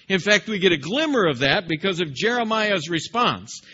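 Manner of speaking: ordinary delivery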